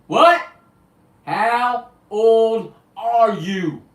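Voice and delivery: loud high shout, dragged very slowly; shouting the question. Retold or imitated. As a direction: bellow each word so a deaf man hears. Repeating to deaf man.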